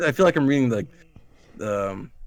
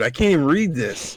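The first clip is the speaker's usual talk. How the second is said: usual speaking voice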